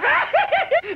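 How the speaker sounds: high-pitched laughing burst; cracking up. Real, not acted. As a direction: cackle at your own comeback. Laughs at own comeback.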